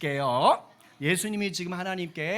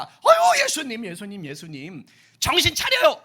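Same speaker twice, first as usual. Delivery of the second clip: high loud cry then dropping; Martha exclaiming; 5 semitones higher, 8 dB louder than usual. Acted. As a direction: shout at Jesus to come to his senses. Martha voiced scolding Jesus.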